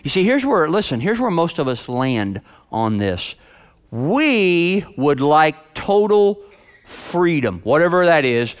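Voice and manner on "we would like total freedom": slow, drawn out. Acted. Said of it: "slow emphatic statement".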